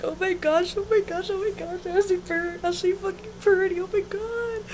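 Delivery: high, breathless fangirl gush; starstruck squeal. Retold or imitated. Fan meeting [name], high starstruck squeal. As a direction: gush in disbelief at meeting him. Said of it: "I was like".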